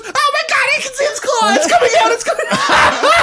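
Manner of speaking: very high, loud hammed-up scream; panicked shriek. Girlfriend sees a claw in the vent, panicked shriek. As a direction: squeal it in mock terror, cracking into laughter.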